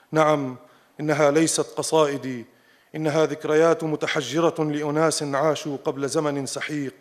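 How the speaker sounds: normal talking voice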